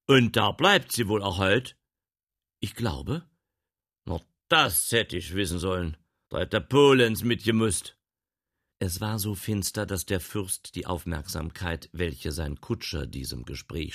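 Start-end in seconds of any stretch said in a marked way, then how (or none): 0.1-8.0 loud, high gentleman's voice; lordly gentleman. Acted. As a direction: grumble like a lord.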